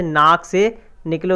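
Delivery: नाक से निकले said slowly, every word weighted; slow emphasis. Shown as a demonstration. Teacher stresses the nasal point to students, slow emphasis. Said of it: "कहाँ से?".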